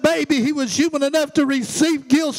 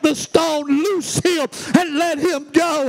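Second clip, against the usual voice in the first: preaching command; 4 semitones higher, slower than usual. Acted. Commanding death in sermon.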